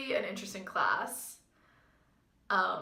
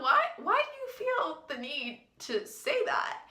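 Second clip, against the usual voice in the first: baffled retort; 7 semitones higher, slower than usual. Retold or imitated. Her baffled reply to the coach.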